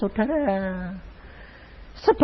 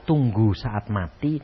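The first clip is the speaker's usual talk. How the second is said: grave doctor; 7 semitones lower, 4 dB quieter than usual. Retold or imitated. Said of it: "dokter bilang".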